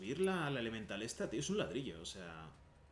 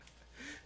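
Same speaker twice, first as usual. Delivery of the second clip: high giggle; 11 dB quieter than usual. Real, not acted. Laughing at own voice.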